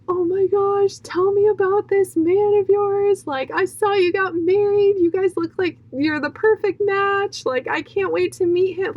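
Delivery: high, gushing excited greeting; bubbling over with excitement; overjoyed. Retold or imitated. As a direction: gush in a high voice, thrilled for your friend. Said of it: "I'm just so overjoyed to talk to her".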